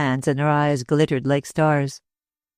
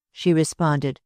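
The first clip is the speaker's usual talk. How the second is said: ordinary delivery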